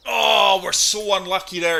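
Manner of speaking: loud, high groan of bad luck; dismayed cry. Real, not acted. Ball hangs up in rough.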